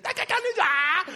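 high, loud babbling shriek; imitated toddler babble. Toddler getting in baby brother's face, high shrieking babble. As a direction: babble wildly like a small child, up to a shriek. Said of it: "and he's like".

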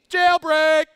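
high, loud, gleeful shout; a thrilled prisoner shouting about the escape; excited cry. Acted. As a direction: yell it like the doors just burst open. The prison doors fly open.

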